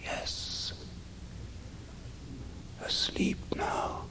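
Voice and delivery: breathless, ghostly whisper from a dying man; barely audible whisper. A narrator's reading. Dying Valdemar answers the mesmerist from his trance, in a faint, slow whisper. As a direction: let the words barely escape the lips, slow and drawn out. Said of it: "in a barely audible whisper".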